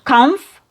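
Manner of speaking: "Comf" as one strong "kumf" beat; emphasized syllable. Shown as a demonstration. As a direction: stress "Comf" with a short U. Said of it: "it's emphasized".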